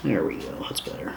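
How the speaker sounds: whispered under the breath to himself; quiet satisfied whisper. Real, not acted. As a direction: breathe it out, almost silent. Quiet fix at the workbench.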